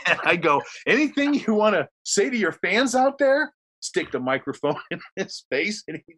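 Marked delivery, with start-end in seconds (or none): 0.8-3.5 high, bright talk-show host question; lightly hammed-up interviewer; mock host question. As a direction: push the mic at him cheerily. Host pushes mic to guest.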